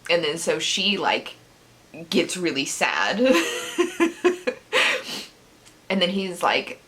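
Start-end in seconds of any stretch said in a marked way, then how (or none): 3.2-5.2 long high giggle; real high, airy laughter. Real, not acted. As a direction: giggle helplessly for a moment. Laughs at the sad plot.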